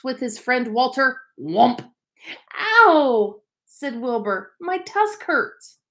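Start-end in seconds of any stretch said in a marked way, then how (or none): 2.5-3.4 high, loud cry of pain; young walrus crying out mid-wrestle. Acted. Tusk hit while wrestling. 4.6-5.8 higher, slow whimper; whiny little walrus. Acted. Wilbur complains again.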